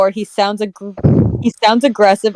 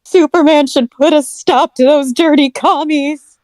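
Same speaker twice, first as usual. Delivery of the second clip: 1950s soap opera voice; 7 semitones higher, 3 dB louder than usual. Acted. Acting Lois in soap voice.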